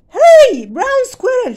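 loud, high urgent call. Acted. Grandmother calling squirrel home, loud and high. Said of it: "she called".

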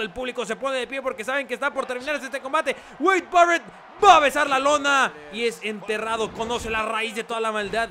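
yelling the finisher call; big loud match finish; shouted commentary. Acted. Finishing move called out.

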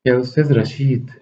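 Zaza calling. Acted. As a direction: call out to him on the line. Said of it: "جاء صوتها يناديني".

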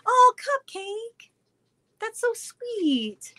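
high, slow, melting thanks; touched. Real, not acted. Reading a fan's praise, high slow touched thanks. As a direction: gush over a kind fan message.

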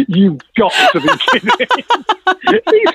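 slow, high mock-stunned NASA official; stunned disbelief. NASA contact hearing the return request, slow high disbelief. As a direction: drag it out like he can't believe it.

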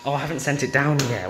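ordinary talk, nothing marked

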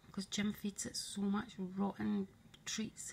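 normal talking voice

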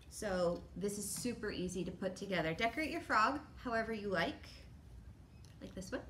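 usual speaking voice

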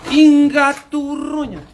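slow, loud and high shocked outburst; startled curse. Real, not acted. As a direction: yelp it out in fright. Startled by a noise.

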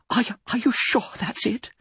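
high, thin, hesitant question; frightened whisper. A narrator's reading. Quivering wife asking her husband, frightened whisper. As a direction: stammer it fearfully, pitched very high. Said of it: "she whispered".

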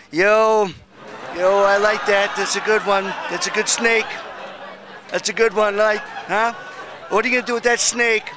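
street-tough actor voice, mildly hammed; Robert De Niro impression. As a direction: lean into a swaggering De Niro tough guy. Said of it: "if Robert De Niro was here ... he'd go up to you and say".

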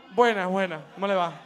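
put-on shy kid's greeting. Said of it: "Y uno".

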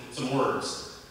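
ordinary talk

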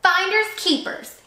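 slow gloating growl, pitched high; a mild mock growl. A narrator's reading. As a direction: refuse her with a gloat. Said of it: "he growled".

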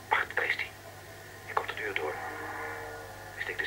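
sudden unvoiced whisper; urgent whisper. Agent whispering as the suspect comes through the door. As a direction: hiss it as the target appears.

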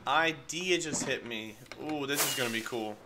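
usual speaking voice

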